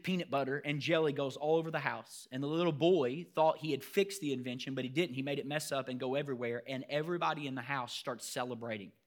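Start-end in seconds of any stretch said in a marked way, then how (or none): none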